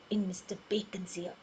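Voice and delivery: unvoiced whisper. A narrator's reading. Narrator whispering as Missus Crumbs tells her plan. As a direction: breathe it like a secret. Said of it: "She whispered".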